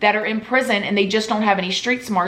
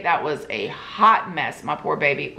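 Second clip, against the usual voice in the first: usual speaking voice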